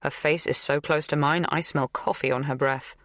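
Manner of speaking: ordinary delivery, nothing marked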